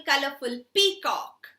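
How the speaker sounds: usual speaking voice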